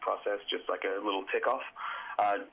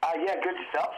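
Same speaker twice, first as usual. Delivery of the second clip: over the phone; 6 semitones higher than usual. Real, not acted. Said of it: "I'm on the phone right now".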